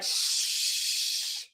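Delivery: whispered hush; shushing. Retold or imitated. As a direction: shush him to keep quiet. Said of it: "people around him were like".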